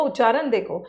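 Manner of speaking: ordinary talk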